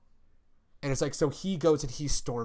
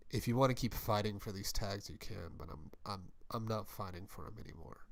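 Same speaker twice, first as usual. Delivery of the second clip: quiet menace; 4 semitones lower, 10 dB quieter, faster than usual. Retold or imitated. Warwick telling Alex he's done, much softer and cold. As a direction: drop to a low, much softer voice, cold and final.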